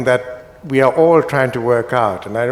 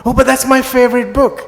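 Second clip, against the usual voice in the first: high, delighted exclamation of recognition; mock astonishment, squealed up high; imitating a reader who suddenly recognises the book; surprised; 11 semitones higher, 4 dB louder than usual. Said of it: "and say".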